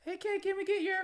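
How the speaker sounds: high-pitched fan imitation; high mock fan voice. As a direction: squeak it out like an eager fan. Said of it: "this is what they heard".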